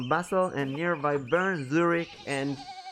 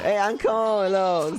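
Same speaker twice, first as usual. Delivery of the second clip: higher, louder in-character greeting; acted friendly hail; 6 semitones higher, 4 dB louder, slower than usual. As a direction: call out to your uncle in character. Acts out hailing the uncle.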